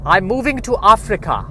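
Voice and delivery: put-on high-pitched, terrible Dutch accent; bad Dutch accent. Imitating Meryl Streep in the movie, bad Dutch accent. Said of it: "That is the worst Dutch accent".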